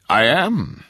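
smug, lifted pitch; self-satisfied tease; smirking. A narrator's reading. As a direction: answer with a smirk. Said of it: "he smirked".

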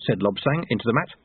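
ordinary delivery, nothing marked

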